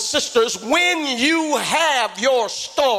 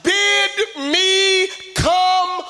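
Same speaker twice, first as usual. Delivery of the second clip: calling out; 6 semitones higher, slower than usual. Acted. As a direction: cry out to Jesus across the storm. Peter calling to Jesus.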